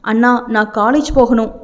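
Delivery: plain reading voice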